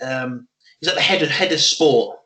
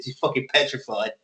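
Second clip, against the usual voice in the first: usual speaking voice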